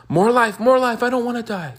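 high, loud begging for life; hammed-up panicky wail; mock desperate pleading. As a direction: beg in an exaggerated high whine. Mimicking people clinging to life.

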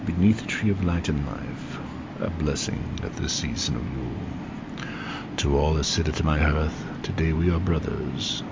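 loud, solemn prayer voice; prayer reading. Acted. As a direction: read it out like a solemn blessing. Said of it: "I want to read to you a short prayer".

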